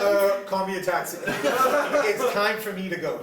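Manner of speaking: high, loud, slow, laughing; laughing punchline. Real, not acted. Answering his friend's dog question, laughing slowly. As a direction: deliver the exit line cracking up.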